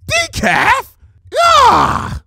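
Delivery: very high, loud outraged scream; shrieking disbelief then a cry; horrified shriek. Acted. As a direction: scream the word decaf in horror. Twilight horrified at decaf.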